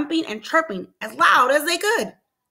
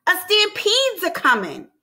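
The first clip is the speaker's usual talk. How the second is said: high-pitched storybook alarm; exclaimed warning; 6 semitones higher than usual. A narrator's reading. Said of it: "she exclaimed".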